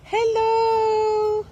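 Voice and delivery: high cooing call; sing-song calling the pet. Real, not acted.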